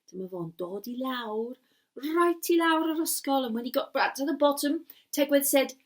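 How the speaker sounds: ordinary delivery, nothing marked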